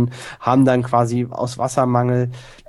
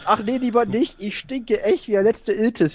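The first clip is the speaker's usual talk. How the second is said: high, overwhelmed greeting; hammed-up high relief; acted reunion joy; 10 semitones higher than usual. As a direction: gush at a long-lost friend.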